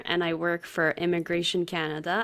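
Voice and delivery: ordinary delivery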